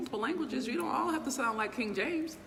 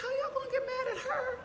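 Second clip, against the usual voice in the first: much higher, laughing mock outrage; high laughing voice mocking the haters; laughing disbelief. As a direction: laugh through an incredulous rant. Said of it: "I'm like".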